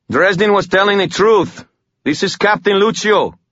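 pitched high, pressing the truth; urgent insistence. A narrator's reading. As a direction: insist on it before he strikes. Urgent shouting to halt an attack.

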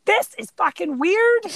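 mock exasperated cry; exasperated outburst. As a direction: blurt it out, done with this. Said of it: "her being like".

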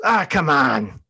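irritated come on; fed up. Retold or imitated. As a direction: done being nice, push back. Fed-up man snaps at leader.

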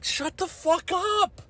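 telling the photographers to go home, high mock exasperation; exasperated retort. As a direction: fire back in a squeaky, fed-up mock voice. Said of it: "and it's like".

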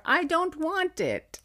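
put-on high, slow whiny little-kid cry; toddler protest. Mom imitating her son yelling in the sea, high toddler voice. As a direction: protest like a two-year-old in the sea. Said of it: "you'd yell".

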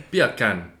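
laughing into a Jamaican "Beer can"; half-laughing Jamaican accent; mock Jamaican accent. Shown as a demonstration. Co-host ends the accent bit, laughing mock Jamaican. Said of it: "Sounds like bacon in Jamaican accent".

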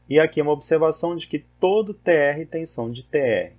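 ordinary delivery

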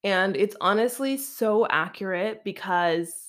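usual speaking voice

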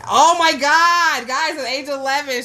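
high, drawn-out oh-my-God exclamation; too excited. Real, not acted. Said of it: "I just got too excited right now".

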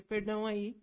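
ordinary talk

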